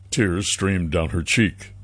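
ordinary delivery

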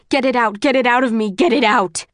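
loud, frantic repeated yelling. A narrator's reading. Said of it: "he yells".

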